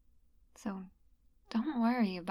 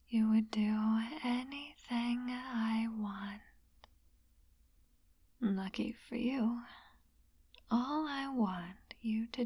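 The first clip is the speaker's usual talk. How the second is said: slow hypnotic whisper; mesmerizing; 4 dB quieter than usual. Acted. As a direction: lull them under, softly.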